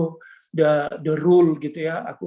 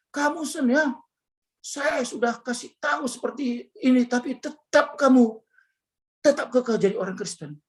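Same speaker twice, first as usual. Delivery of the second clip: higher-pitched, mildly threatening wife; retold threat; 6 semitones higher than usual. Wife warns husband on a second phone call, mildly threatening imitation. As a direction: imitate a wife's warning, insistent and raised.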